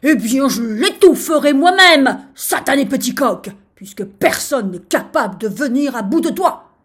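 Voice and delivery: very loud, beside-himself outburst; enraged shouting. A narrator's reading. Sultan vows to smother rooster himself, shouting. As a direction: yell it in high-handed rage. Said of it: "se mit à crier".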